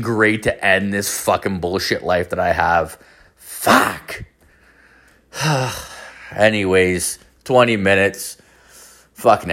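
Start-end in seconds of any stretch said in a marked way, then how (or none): 3.4-4.2 sudden high cracked curse; real frustrated yelp; exasperated outburst. Real, not acted. Vents after bleak remark. 5.3-5.8 long exhaled sigh; heavy sigh. Real, not acted. Sighs after the outburst.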